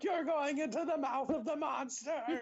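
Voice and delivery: very high, breathy melodrama; overacted radio plea; dramatic movie heroine. Mock dramatic radio line.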